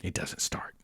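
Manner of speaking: whispered, hushed punchline; dramatic whisper. Real, not acted. Telling the ghost story, whispering the scary beat. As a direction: drop to a whisper for suspense. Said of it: "hushed dramatic narration".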